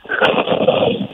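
snore that breaks into giggles; laughing snore. Real, not acted. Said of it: "who laughs in their snore".

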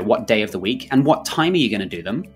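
usual speaking voice

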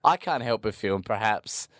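ordinary talk

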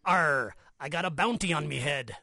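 low, growly-free pirate voice, lightly done. Acted. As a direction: say it like a jolly pirate. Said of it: "And the pirate said".

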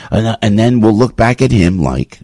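usual speaking voice

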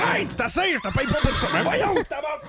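yelling at the horse. Acted. Old man yelling at his horse.

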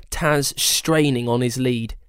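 plain reading voice

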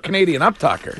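normal talking voice

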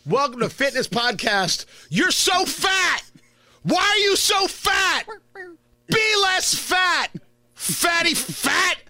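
high, slow, shouted drill-sergeant host; mock gym host yelling at a listener; fitness podcast parody. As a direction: bellow insults like a mean fitness coach. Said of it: "I'm gonna do my impression right now of a fitness podcast".

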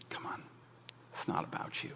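whispered, breathy aside; mock whisper. Preacher quietly chiding as if to Elkanah, whispered. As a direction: hush it almost to nothing.

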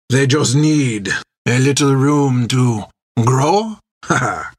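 theatrical foreign sailor, relishing his speech; suave Armand, self-assured. Acted. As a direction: explain proudly, ending on a dry laugh. Voices Armand explaining the ship.

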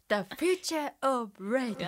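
slow, carefully pronounced English; English phrase. Shown as a demonstration. Host intoning the show's English theme, slow and deliberate. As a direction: say it slowly like a grand English title. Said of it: "英語の発音素晴らしいけど".

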